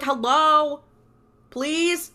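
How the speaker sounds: high, loud mock plea for attention; insistent calling out. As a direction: imitate someone being ignored, pitch lifting. Ignored Dean tries getting attention.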